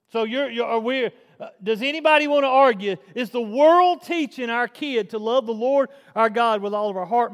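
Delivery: fired-up sermon challenge; forceful preaching. Real, not acted. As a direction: press the congregation with emphatic rhetorical questions. Preaches with rhetorical questions.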